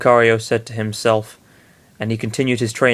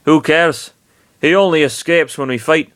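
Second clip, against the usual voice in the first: bad Scottish accent; 6 semitones higher, 3 dB louder than usual. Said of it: "fucking awful Scottish accents".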